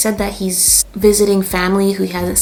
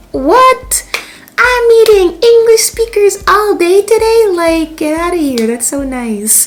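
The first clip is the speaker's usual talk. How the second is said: excited squeal; 11 semitones higher, 7 dB louder, slower than usual. Retold or imitated. Excited reply to English speakers.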